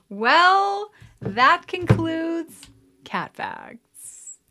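high, loud, slow outro announcer; cheery sign-off. Real, not acted. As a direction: wrap the show up brightly. Signing off the episode.

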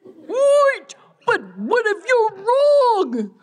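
high, worried whine; whiny alarmed protest. Acted. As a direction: cry out, worried.

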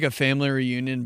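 ordinary delivery

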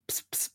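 faint unvoiced squirting noise; water squirt sounds. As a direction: hiss like a spray bottle. Spraying water in his ear.

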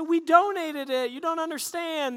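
high-pitched husband's protest; defensive protest. Retold or imitated. Husband protesting to angry wife.

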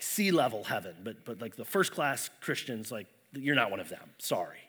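a lightly voiced God letting you down easy; imitating dismissive God. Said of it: "maybe God's gonna be like".

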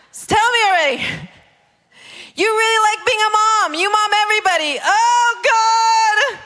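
high wail building to a cry; overwhelmed exasperation. Acted. Believer hearing God list her faults, exasperated wailing.

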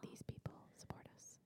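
soft whispered roll call; whispering. Acted. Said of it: "it was a whisper time".

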